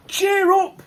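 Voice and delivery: high, loud call out; shouted cheer up. Acted. Vlogger shouting at a gloomy world, loud. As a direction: yell it at the whole world.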